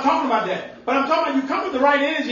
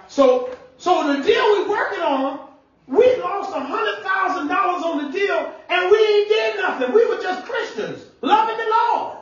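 much higher, rising preached telling; preaching at full force; 8 semitones higher than usual. Acted. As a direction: climb higher with each phrase to the crowd. Preacher telling the farm deal.

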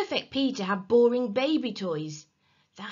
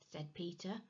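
ordinary delivery, nothing marked

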